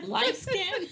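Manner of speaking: high, laughing disbelief; incredulous. Retold or imitated. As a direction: echo it back in amused disbelief. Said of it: "we just look at each other like".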